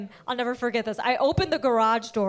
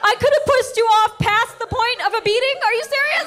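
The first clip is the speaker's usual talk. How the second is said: high, loud incredulous rant; pitched-up outrage at a mother who never hit her; outraged disbelief; 11 semitones higher, 3 dB louder, faster than usual. Real, not acted. Comic outrage at a mom's secret, pitched high and loud.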